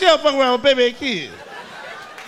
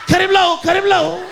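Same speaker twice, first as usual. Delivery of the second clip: high, loud chant; gleeful kid chant; kids egging on; 8 semitones higher, 6 dB louder than usual. Retold or imitated. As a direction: egg on the fight like a wild kid.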